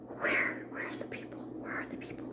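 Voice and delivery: hushed, whispered searching questions; urgent whisper. Retold or imitated. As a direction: whisper it while searching for someone hidden. Searching at window for hidden people.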